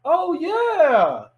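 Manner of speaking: high, drawn-out imitation; retold gushing realization; delighted recognition.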